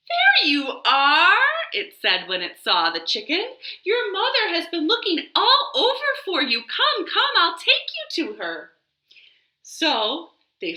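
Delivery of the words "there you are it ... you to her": high, bright-paced bird voice; a bustling little bird; chirpy bird. Acted. Bird telling the chicken his mother looks for him, high chirp.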